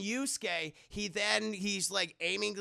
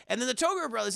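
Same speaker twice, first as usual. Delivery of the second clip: ordinary talk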